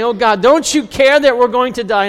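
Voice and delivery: panicked disciples. Retold or imitated. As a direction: cry out like men sure they'll drown. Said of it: "They were saying".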